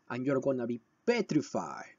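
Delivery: ordinary talk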